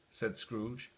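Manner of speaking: ordinary delivery, nothing marked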